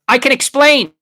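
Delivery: high, loud panicked protest; alarmed. A narrator's reading. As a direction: blurt it out as if caught red-handed. Stowaway caught hiding in drawer.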